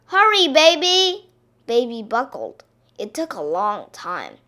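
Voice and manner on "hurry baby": loud, high hurry-up call. A narrator's reading. Mister Duck hurrying Baby, calling out. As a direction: holler to hurry the child along. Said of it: "called Mister Duck".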